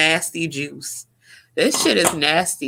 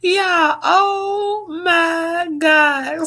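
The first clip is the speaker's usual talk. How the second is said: high, teary laugh-cry; real laugh-crying, voice breaking high; crying with laughter; 10 semitones higher, much slower than usual. Real, not acted. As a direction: laugh till the tears come. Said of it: "Y'all about to cry real tears".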